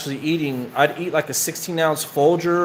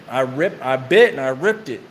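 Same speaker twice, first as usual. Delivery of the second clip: usual speaking voice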